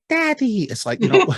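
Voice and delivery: put-on very high little baby saying Daddy. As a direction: squeak it out like a tiny child. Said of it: "looks at the dog and goes".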